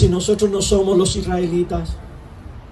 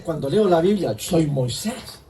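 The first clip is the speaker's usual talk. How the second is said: slowed right down and much softer; 4 semitones lower, 4 dB quieter than usual. Shown as a demonstration. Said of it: "rhetorical repeat of the line".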